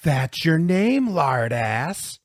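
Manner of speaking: loud, drawn-out, offhand jeer; careless taunt. A narrator's reading. Bully answering the red-faced boy, carelessly taunting. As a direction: toss the nickname back loudly, swinging up at the end. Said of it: "said Woody carelessly".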